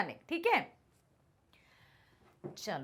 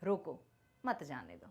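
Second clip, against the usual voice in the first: soft, low रोको, short pause, then मत जाने दो; pause after रोको; 9 semitones lower, 8 dB quieter than usual. Shown as a demonstration. Teacher demonstrating the comma to students, pausing after रोको. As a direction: break after रोको, dropping lower on मत जाने दो. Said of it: "थोड़ा सा हमें रुकना पड़ेगा".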